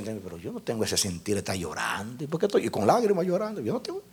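very soft, puzzled talking to himself; hushed private questioning; inner self-talk. Retold or imitated. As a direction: think aloud under your breath, baffled. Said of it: "Ese yo entre mí".

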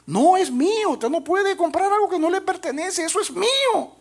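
put-on a small boy demanding his boat back; child insisting. Boy arguing with the shopkeeper, high pleading child voice.

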